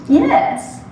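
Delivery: a clipped, high pseudo-British yes; pseudo-British professor answering a student; crisp pseudo-British accent. Acted. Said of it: "in his crisp, pseudo-British accent".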